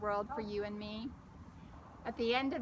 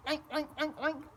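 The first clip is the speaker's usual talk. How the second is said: pig oinks; 4 semitones higher than usual. Acted. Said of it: "Bo oinked".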